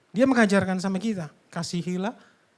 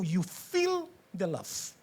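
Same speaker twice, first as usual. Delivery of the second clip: normal talking voice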